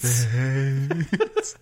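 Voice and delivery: Spates stretched long with a rising tail; drawn-out name. Shown as a demonstration. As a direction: show off a long-held vowel in Spates. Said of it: "say it like that every time".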